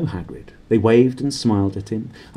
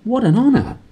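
awed; 8 semitones higher than usual. Acted. Barman awed, voiced.

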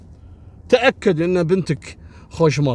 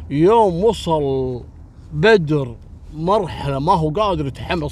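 normal talking voice

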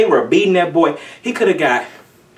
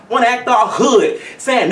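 normal talking voice

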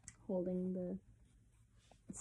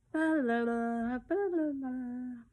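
put-on high, drawn-out baby murmur; crooned, sing-song babbling; baby babble; 3 semitones higher, 4 dB louder, much slower than usual. A narrator's reading. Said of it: "murmured Barbara".

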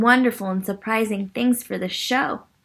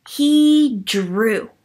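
ordinary delivery, nothing marked

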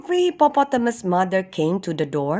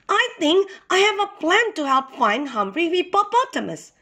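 shouting; 8 semitones higher than usual. A narrator's reading. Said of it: "shouted a little girl hippo".

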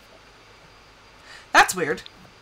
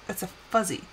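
ordinary talk

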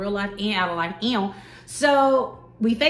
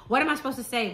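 ordinary talk, nothing marked

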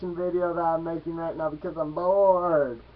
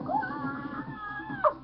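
very high, softer mock scream; imitated squeal; 6 dB quieter than usual. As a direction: squeal thinly like a cartoon figure. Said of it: "you got one like".

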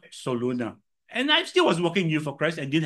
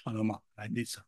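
softer, caught-out mumble; sheepish answer; 6 semitones lower, 11 dB quieter, faster than usual. Retold or imitated. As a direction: admit it meekly to your wife. Sheepish reply to rebuking wife.

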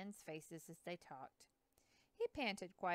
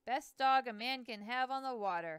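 louder, proud boatman's boast; proud declaration; 4 semitones higher, 8 dB louder than usual. Acted. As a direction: declare it with pride in the dog. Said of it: "Hanson declared proudly".

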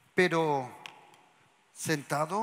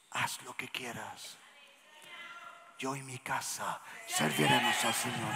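calm whisper to the devil; 8 semitones lower, 7 dB quieter than usual. Shown as a demonstration. Telling the devil calmly to his face, whispered. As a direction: whisper it, totally cool and unafraid. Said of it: "puedes decirle al diablo en la cara".

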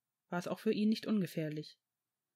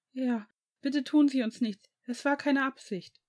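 higher, louder, anxious plea; anxious pleading; 6 semitones higher, 4 dB louder than usual. A narrator's reading. Ian caught by a centaur in the forest, anxious pleading. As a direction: beg not to be harmed.

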